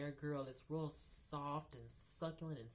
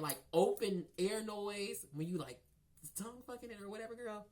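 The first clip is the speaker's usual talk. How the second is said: ordinary talk, nothing marked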